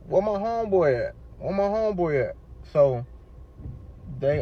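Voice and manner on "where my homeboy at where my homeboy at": high-pitched retold demand; insistent questioning. Patient asking hospital staff about his friend, insistent questioning. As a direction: press them for an answer.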